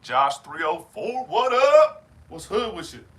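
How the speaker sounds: loud greeting, rising high on "up"; calling out. Real, not acted. Streamer hollering hello to a viewer in chat, loud and high. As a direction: hail him like he just walked in.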